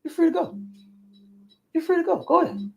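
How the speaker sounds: high, soft voicing of the captor; kidnapper letting her go. Captor telling captive she is free, high soft voice. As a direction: lightly play the man releasing her. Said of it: "And then the man say".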